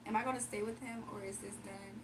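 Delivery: ordinary talk, nothing marked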